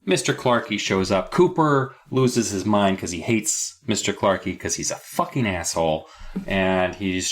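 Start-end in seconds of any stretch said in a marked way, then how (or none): none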